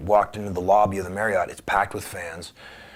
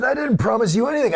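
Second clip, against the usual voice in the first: much higher, louder indignant reply; 5 dB louder than usual. Retold or imitated. He snaps back at the caller on the phone, high indignant retort. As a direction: push back hard on him.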